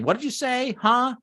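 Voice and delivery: high-pitched confused questioning; puzzled listener. Acted. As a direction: ask it like you didn't catch a word. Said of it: "if you get people saying".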